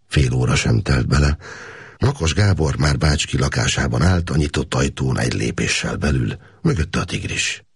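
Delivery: ordinary delivery, nothing marked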